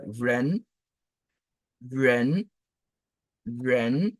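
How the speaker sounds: "vren" gliding upward; rising model "vren"; second tone. Shown as a demonstration. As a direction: start "vren" low and let it climb. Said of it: "le deuxième ton".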